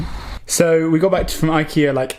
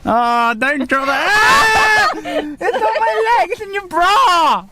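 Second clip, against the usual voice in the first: high, drawn-out yelps of complaint; pained protest; 10 semitones higher, slower than usual. Real, not acted. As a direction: wail in protest, voice pitched way up. Yelping over item dropped on leg.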